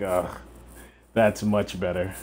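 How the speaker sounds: sarcastic mock approval. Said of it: "I'm being sarcastic".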